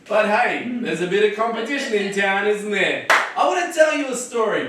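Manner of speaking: high, friendly crowd-facing intro. Shown as a demonstration. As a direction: win over the street with a chatty intro. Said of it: "Rapport being like a connection".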